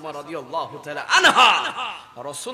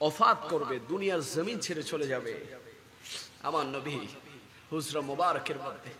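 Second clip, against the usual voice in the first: much softer, low and grave; hushed narration; 4 semitones lower, 10 dB quieter than usual. A narrator's reading. Preacher tells of the Prophet's last hours, hushed. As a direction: let the voice fall away in short phrases.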